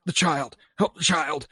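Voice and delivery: retold panic, mildly acted; scared, worried. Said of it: "just sounds scared and worried".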